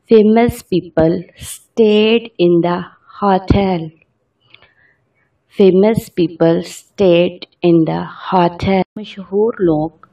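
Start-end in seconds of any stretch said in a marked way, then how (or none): 0.1-3.9 very slow, word by word dictation; slow example sentence. Shown as a demonstration. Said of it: "इसका sentence में use ऐसे करेंगे". 5.6-8.8 very slow, word by word dictation; slow example sentence. Shown as a demonstration. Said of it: "इसका sentence में use ऐसे करेंगे".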